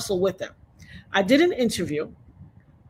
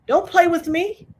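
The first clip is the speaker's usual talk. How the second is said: warning; 9 semitones higher than usual. Real, not acted.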